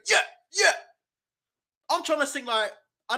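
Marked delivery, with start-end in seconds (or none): 0.1-0.7 imitating a hyped-up boxer, higher and louder; mock the fighter pumping himself up. Streamer mimics Frazer Clarke strutting the ring, hyped mock voice. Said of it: "and he's going".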